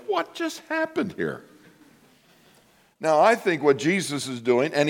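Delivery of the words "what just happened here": bewildered. Retold or imitated. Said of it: "And you're like".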